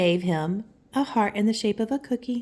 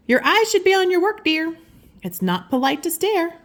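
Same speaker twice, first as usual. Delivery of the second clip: teacher voice; 7 semitones higher, 5 dB louder than usual. Acted. Teacher scolding gently.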